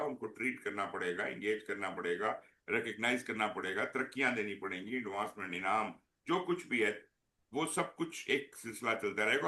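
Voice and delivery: faint mic, fading out; barely audible. Real, not acted. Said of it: "आवाज फिर कम हो गई सर।".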